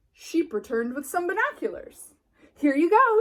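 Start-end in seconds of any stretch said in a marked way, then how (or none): 2.6-3.2 high, loud bright offer; cheerful offer. Acted. Sheep hands Sheepdog the binoculars, high and loud. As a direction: hand it over beaming. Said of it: "she said".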